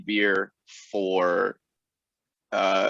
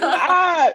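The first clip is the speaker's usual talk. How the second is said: high exclaimed ah; 7 dB louder than usual. Real, not acted. Guest reacts jokingly about beer.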